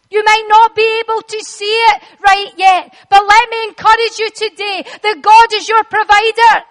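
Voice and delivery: rising, loud preaching build; full-force preaching. Acted. Preacher urging the congregation, building to a loud proclamation. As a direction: preach it out to the church.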